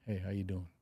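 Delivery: a greeting that could scare someone; low menacing greeting. Shown as a demonstration. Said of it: "You might be kinda scared".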